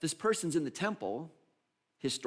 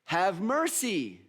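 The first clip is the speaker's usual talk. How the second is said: high, loud, drawn-out plea; yelling out; 7 semitones higher, 5 dB louder, slower than usual. Acted. Blind man yells out to passing Jesus, pleading loudly. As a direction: call out slowly over the crowd, pleading. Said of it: "And he yells out".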